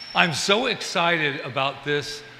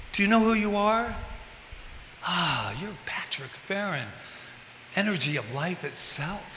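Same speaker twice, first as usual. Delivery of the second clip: put-on tender inner child talk; 7 dB quieter than usual.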